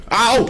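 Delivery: high loud pained yelp; sharp yelp. Real, not acted. Gamer taking a hit, high loud yelp.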